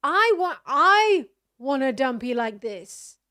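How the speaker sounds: loud, fervent demand; emphatic wanting. Real, not acted. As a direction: declare it at full volume, carried away. Excited envy over mouse video.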